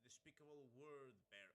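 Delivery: mechanical filtered voice; robot voice. Shown as a demonstration. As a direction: speak through a robot filter. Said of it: "test it out to see if it sounds good".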